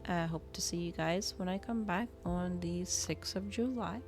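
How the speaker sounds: ordinary talk, nothing marked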